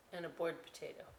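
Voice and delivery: plain reading voice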